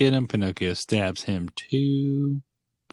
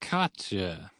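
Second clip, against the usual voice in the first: softer, understated mock "gotcha"; nerdy sidekicks; 7 dB quieter than usual. Werewolf and fox chiming in, softly imitated. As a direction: drop it softly, imitating the nerds. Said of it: "they're like".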